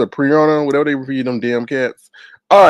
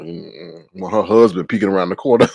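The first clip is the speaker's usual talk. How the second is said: ordinary talk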